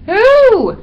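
very high, loud, drawn "who"; hooting impatient shout; exasperated demand. A narrator's reading. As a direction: cry it out, desperate to know. Dog asks again, more urgently.

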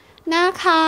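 put-on high childish plea; little-girl please; coaxing little girl.

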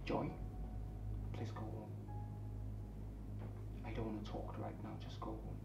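very soft, low, drained plea; subdued dismissal. Acted. Hurt man asks Joy to go home, subdued. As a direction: send them away under your breath.

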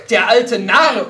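loud, high, drawn-out scorn; scornful general's wife interrupting; cutting interruption. A narrator's reading. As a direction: break in forcefully with contempt. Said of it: "unterbrach die Generalin".

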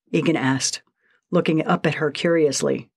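ordinary delivery, nothing marked

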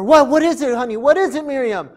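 put-on a worried mother checking on her girl; anxious mother.

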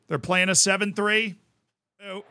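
ordinary talk

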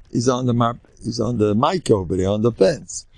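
normal talking voice

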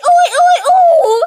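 high, loud yell of oi broken by laughter; laughing shout. Acted. Kid yelling the catchphrase at the camera, laughing and loud. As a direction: shout it and crack up.

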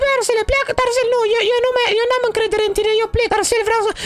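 squeaky high cartoon voice, fussing; a high, excited toy character scolding his friend. Same character, offended. (voce de personaj ascuțită)

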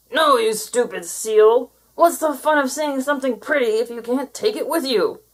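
angry creature. Acted. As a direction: snap back with scorn. Creature refuses angrily.

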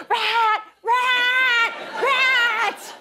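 high shouted rat cries; imitated loud high panicked shouts; frantic yelling. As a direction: yell rat over and over.

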